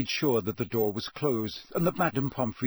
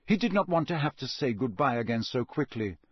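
usual speaking voice

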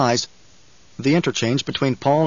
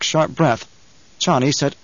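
ordinary delivery

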